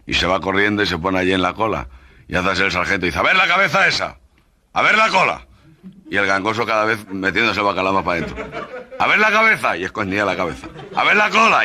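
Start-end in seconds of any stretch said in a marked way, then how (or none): 3.2-5.5 high, loud sergeant's orders; barking sergeant. Acted. Sergeant ordering the soldier at roll call, loud and high. 9.0-9.8 much higher, louder command; escalating sergeant order. Acted. Sergeant commanding the soldier to show his head, shouting. 10.9-11.6 much higher, louder command; escalating sergeant order. Acted. Sergeant commanding the soldier to show his head, shouting.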